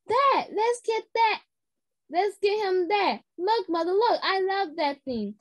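grab mother's attention; excited. Acted. Girl begging mother for the tool belt, excited.

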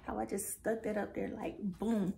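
usual speaking voice

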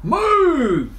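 high, loud, drawn-out "move"; shouted command. A narrator's reading. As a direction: knights shouting someone out of danger. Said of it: "they shouted".

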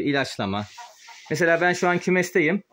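normal talking voice

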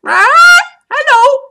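very high, loud squawked greeting; parakeet squawk. Retold or imitated. Rival parakeet squawking hello in the parrot's face. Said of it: "what that crazy parakeet says".

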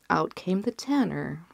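ordinary delivery

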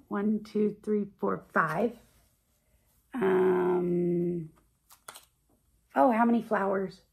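usual speaking voice

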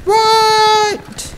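a huge, high, drawn-out squeal of disbelief; mock outrage. Ranting gamer squeals at English slang in mock disbelief. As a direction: leap way up in pitch, fake shock.

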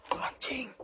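a hushed, breathless curse; whispered curse in the dark; startled whisper. Real, not acted. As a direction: swear under your breath in fright. Ghost hunt, sudden scare.

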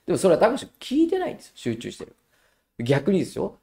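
usual speaking voice

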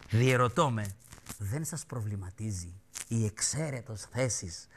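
slow, low church-letter reading; solemn reading. Retold or imitated. Host reading the bishop's letter, slow solemn tone. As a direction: read it slowly like a grave bishop.

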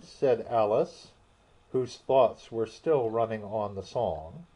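usual speaking voice

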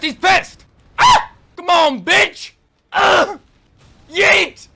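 high, loud yelps and cries mid-fight; frantic shouts. Real, not acted. Player shrieks through a frantic boss fight. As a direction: yell in the heat of the dodge.

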